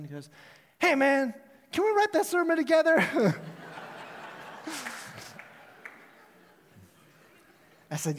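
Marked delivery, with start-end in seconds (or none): 0.8-3.0 much higher, loud stoner voice; stoned caller. Retold or imitated. Stoned caller offering to write the sermon with the pastor. 3.0-5.4 breathy high stoner giggle. Retold or imitated. Caller giggling at his own joke on the phone.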